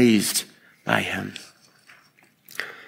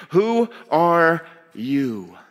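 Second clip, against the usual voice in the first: very slow, higher, spaced-out question; drawn-out, deliberate challenge to the listeners; slow and pointed; 5 semitones higher, much slower than usual. Shown as a demonstration. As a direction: stretch each word out on its own. Preacher repeats his opening question.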